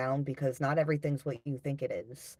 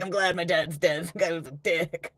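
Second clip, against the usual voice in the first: louder, blunt mock callousness, laughing at the end; mock callous child; 6 dB louder than usual. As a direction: play the unsentimental kid with a grin. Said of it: "They're like".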